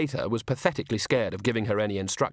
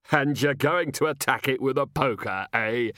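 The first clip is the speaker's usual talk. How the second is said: amused gentleman guest; 3 semitones higher than usual. Acted.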